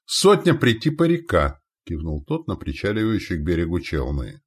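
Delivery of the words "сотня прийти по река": high, loud reply; foreign warrior ally. Acted. Ally counting troops for commander, foreign warrior. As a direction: answer briskly in broken Russian.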